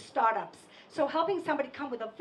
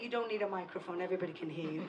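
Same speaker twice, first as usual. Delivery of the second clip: much lower, softer husband voice; husband's low deadpan; 7 semitones lower, 10 dB quieter, much faster than usual. Retold or imitated. Husband teasing her: low, soft, dry. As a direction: mutter it dryly like her husband. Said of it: "my husband would say".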